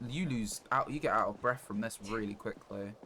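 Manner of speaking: usual speaking voice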